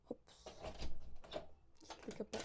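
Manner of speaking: hushed whisper at the door. Real, not acted. Outside the baby's locked door, hushed whisper. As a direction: whisper so as not to wake anyone. Sneaking to sleeping baby.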